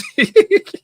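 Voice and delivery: high burst of laughter; a big high laugh; loud laugh. Real, not acted. Laughing at his own tangents, a loud high laugh.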